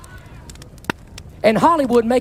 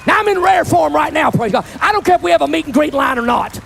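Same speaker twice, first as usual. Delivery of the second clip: full-force preaching; 5 semitones higher than usual. Real, not acted. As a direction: preach it hard to the crowd.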